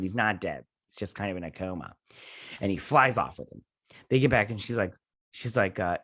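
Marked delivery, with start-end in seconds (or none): none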